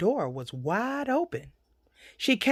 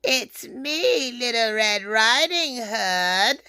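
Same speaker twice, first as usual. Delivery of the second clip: slow, wobbly put-on girlish voice; wolf badly imitating a little girl; fake little-girl voice; slower than usual. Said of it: "in his best little girl voice".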